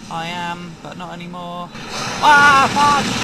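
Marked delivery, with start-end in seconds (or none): 2.2-3.2 loud, high cry of pain; pained shout. Real, not acted. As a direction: yell as it hurts. Hit, cries out.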